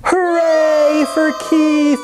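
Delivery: high, drawn-out hooray; cheering cry. A narrator's reading. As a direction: cheer slowly and high, holding each word. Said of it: "cried the cats".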